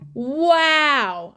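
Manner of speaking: loud, drawn-out wow; awed wow. Real, not acted. Mother raving about the Florida hospital, loud amazement. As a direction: let out a big impressed wow.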